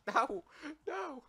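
high, loud panicked cries. Real, not acted. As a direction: cry out in panic. Panicked loss in game.

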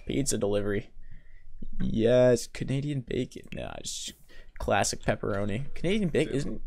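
usual speaking voice

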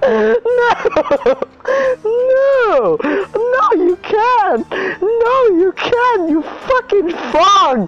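very high, loud wailing, words stretched out; real shrieking meltdown, half laughing, half crying; crying, hysterical screaming. Real, not acted. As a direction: scream and wail at the game through laughter. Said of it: "like, crying right now".

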